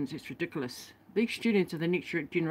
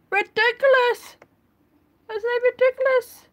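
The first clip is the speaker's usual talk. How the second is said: much higher, louder exclaim; shrieked outrage; 8 dB louder than usual. Real, not acted. Vlogger reacting to family without heaters, high outraged squeal. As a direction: squeal the word in outraged disbelief.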